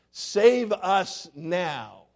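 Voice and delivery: very slow, every word pressed hard; emphatic plea. Shown as a demonstration. The Palm Sunday crowd crying out, a slow emphatic plea. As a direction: plead it out with full emphasis. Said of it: "the emphatic way it's used".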